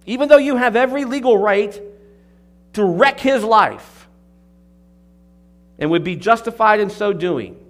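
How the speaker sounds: loud, high, urgent pulpit voice; fervent preaching. Acted. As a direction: press the appeal home from the pulpit. Preaching builds to full force.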